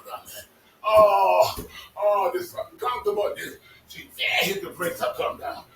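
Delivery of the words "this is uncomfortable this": ordinary delivery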